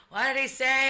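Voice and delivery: high, loud retold holler; yelling old man. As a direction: mimic a hard-of-hearing old guy yelling. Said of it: "yelling at each other".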